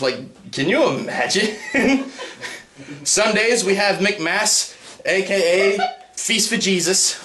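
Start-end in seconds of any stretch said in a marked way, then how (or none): 3.0-7.2 slow, high promo voice; church announcement. Pretend. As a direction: sell it like an ad. Church ad imagined.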